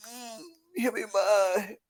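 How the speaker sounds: put-on slow, soft, whiny kid voice; little Cody Rhodes sulking, soft and slow; imitating little Cody. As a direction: pout and whine it. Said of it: "little Cody Rhodes".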